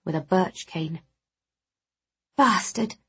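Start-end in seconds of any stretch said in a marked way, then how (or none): 2.4-3.0 mild angry hiss under the breath; hissed curse. A narrator's reading. Healer seeing a friend's fresh wounds, restrained angry hiss. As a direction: curse with a touch of venom. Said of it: "I hissed as I tried to soothe".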